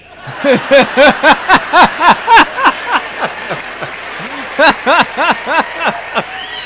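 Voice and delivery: loud, high burst of laughter; long rolling belly laugh; big laugh. Real, not acted. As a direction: crack up at your own story. Laughing at own story.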